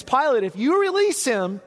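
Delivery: high, pressing voice of the accusers; threatening accusers. Acted. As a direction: lean on Pilate with a veiled threat. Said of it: "what they were saying is".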